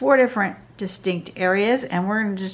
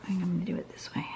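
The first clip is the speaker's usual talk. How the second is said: whispering; 10 dB quieter, much faster than usual. Real, not acted. Muttering to herself while drawing.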